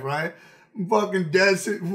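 normal talking voice

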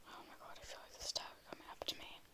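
very soft frightened whisper. Real, not acted. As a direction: whisper in nervous awe. Scared climbing the tower.

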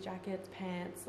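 ordinary delivery

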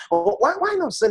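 ordinary talk, nothing marked

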